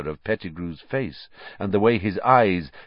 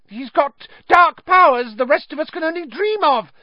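shrill, very high, loud cry; Pettigrew shrill shout; 8 dB louder than usual. A narrator's reading. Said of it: "Pettigrew shouted shrilly".

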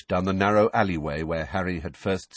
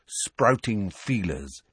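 ordinary delivery, nothing marked

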